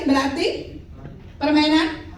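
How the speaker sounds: ordinary talk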